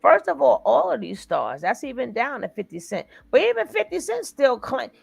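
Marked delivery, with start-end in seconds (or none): 0.0-1.0 loud, punchy restart; emphatic. Real, not acted. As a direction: hit it harder. Repeats louder for emphasis.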